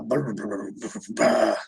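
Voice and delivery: soft low brr lip buzz; lip trill. Real, not acted.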